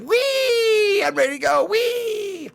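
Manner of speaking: put-on very high, childlike squeal; mock childlike glee at own photo; gleeful squeal. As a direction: squeal like an overexcited kid.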